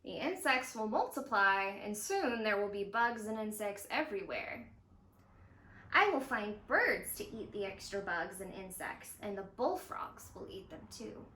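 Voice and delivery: gentle read-aloud narration with voiced dialogue; storybook reading. A narrator's reading. Zoo educator reading a story to young viewers, gentle storytelling. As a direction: read it like a picture book for kids. Reads picture book aloud.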